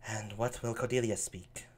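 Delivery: soft, low Cordelia aside; spoken aside. Acted. Cordelia's aside while her sisters flatter Lear, soft and low. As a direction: say it to yourself, unheard by the court. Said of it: "She's saying this aside".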